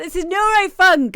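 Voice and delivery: high, loud, proud announcement; friend's answer. Retold or imitated. Friend naming the genre, loud and proud. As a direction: belt it out like he's revealing a secret. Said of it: "he was like".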